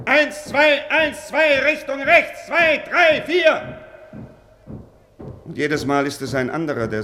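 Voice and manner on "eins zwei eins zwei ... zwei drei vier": loud high marching count; shouted drill count. Acted. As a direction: bark the count at the troops. Drills troops mid-conversation. (lautes Abzählen im Marschtakt)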